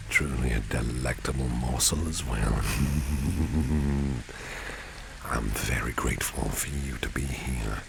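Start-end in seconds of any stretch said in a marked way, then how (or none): 2.7-5.2 long rippling chuckle; flirtatious chuckle. Acted. Boss calls employee a delectable morsel, flirtatious chuckle. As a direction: laugh teasingly after a compliment.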